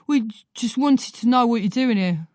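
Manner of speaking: a lightly voiced lad, caught out and stumbling; boy flustered by the woman, stammering; stammering teen boy. A narrator's reading. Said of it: "the tallest one asks".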